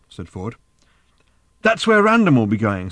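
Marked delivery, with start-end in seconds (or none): 1.6-2.9 sudden realization, higher and louder; with a start. A narrator's reading. Arthur realizing to Ford, startled. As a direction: it hits him all at once. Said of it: "said Arthur with a start".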